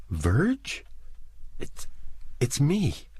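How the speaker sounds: high, faltering and unsure; trembling, halting, high. A narrator's reading. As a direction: stumble over the words in the dark. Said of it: "the faltering voice said".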